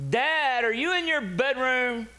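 very high, loud questioning call; child's insistent shout, acted out; imitated daughter hollering. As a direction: holler down the hall for Dad. Daughter calling to the other room.

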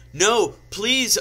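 loud, high-pitched plea; begging. A narrator's reading. Heroine refusing Voldemort's gun, begging. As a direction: beg in hammed-up desperation. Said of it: "I begged".

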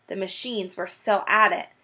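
ordinary delivery

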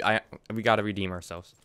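ordinary delivery

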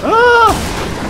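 high, loud yelp; startled scream. Real, not acted. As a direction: shriek at a sudden fright.

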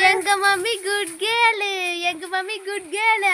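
put-on mother feeding her toddler the line, sing-song; sing-song prompt. Shown as a demonstration.

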